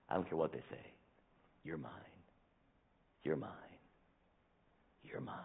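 You're mine fading to a soft whisper; tender whisper. Acted. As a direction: reassure like a parent hushing a child. God reminding his children softly.

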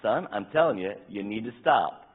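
mock stern dad getting loud; stern parent. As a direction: raise the voice at a child who won't stop. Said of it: "and then he keeps".

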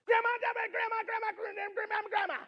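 high, mocking gabble of complaint; mad rant. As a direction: imitate an angry rant on one high note. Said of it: "Why is your dad so mad?".

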